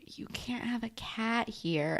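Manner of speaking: teacher hissing. Retold or imitated. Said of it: "my photo teacher was like".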